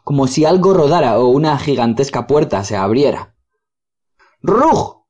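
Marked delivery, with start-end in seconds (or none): 4.4-5.0 very high, sharp yelping "Rug!"; dog's bark. Acted. Dog Boris barks at the approaching Rugs, high yelp. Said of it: "gritó Boris".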